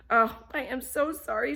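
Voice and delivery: a hint of a preening cat; lightly voiced vain cat.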